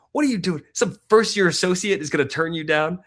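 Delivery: imitating wife's indignant outburst, high and loud; high-pitched mock indignation on the husband's behalf; pissed-off wife. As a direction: fire it off as an outraged, assertive wife. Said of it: "and she's pissed".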